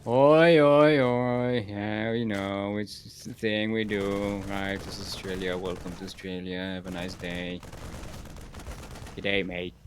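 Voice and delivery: low, slow, unenthusiastic Australian reply; imagined Aussie locals. Retold or imitated. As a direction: play a local dutifully answering the chant. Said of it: "everyone would be like".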